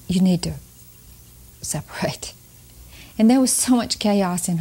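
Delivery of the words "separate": whispered word. Real, not acted. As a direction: drop to a bare whisper on this one word. Pausing before a delicate word.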